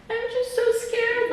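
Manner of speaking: high, slow, sing-song sob-voice; mock tearful whine. Retelling crying to her mom, a high tearful whine. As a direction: whimper it out high and drawn.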